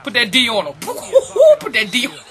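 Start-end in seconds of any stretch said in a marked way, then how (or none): 0.8-1.5 loud, high-pitched laughing whoop. Real, not acted. Player defending in a basketball game, high whooping laugh.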